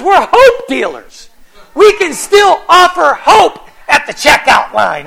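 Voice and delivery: loud, high, ringing pulpit delivery; full-force preaching. Acted. Pastor rallies his congregation: loud, fervent preaching. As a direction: preach it out to the whole church.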